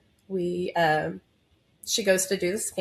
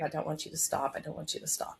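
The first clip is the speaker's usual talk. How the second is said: fading urging; 2 semitones lower, 8 dB quieter, faster than usual. Retold or imitated. Doctor urging, fading quieter.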